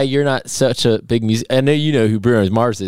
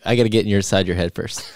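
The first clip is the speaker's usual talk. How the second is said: ordinary talk, nothing marked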